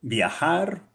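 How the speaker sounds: ordinary delivery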